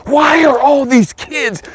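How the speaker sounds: pained cry. Real, not acted. Anguished question about youth suicide.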